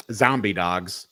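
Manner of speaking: ordinary talk, nothing marked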